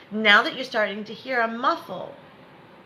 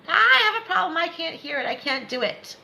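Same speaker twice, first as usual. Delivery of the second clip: high-pitched exaggerated complaint, starting loud; mock distress; 7 semitones higher, 4 dB louder than usual. Coach imitating a student's negative self-talk, mock distressed. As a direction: wail it in mock helplessness.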